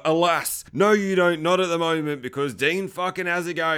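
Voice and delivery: ordinary talk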